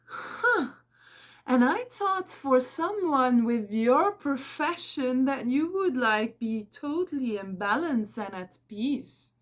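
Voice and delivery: high, slow snide imitation; a colleague mimicked as snide and superior; mocking her. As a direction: mimic her condescending tone, slowed. Retelling the woman's snide remark.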